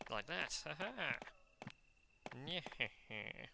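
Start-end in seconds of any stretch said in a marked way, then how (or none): 0.6-1.2 short high "ha ha"; gloating laugh. Pretend. Player getting past a hunter, gloating laugh. 2.5-3.5 slow nyeh-heh-heh snicker; mock villain cackle. Player gloating over the hunter, mock villain cackle.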